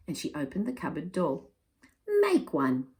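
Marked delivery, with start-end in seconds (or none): 2.1-2.9 put-on high little baby cry; a toddler echoing eagerly. The baby echoes Nonna, a high eager cry. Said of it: "cried the baby".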